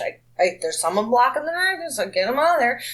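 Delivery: usual speaking voice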